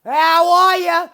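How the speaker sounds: loud, high, slow Boston greeting; hammed-up Boston "How are ya?"; Boston accent. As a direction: throw on a broad Boston accent. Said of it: "he's more from Boston".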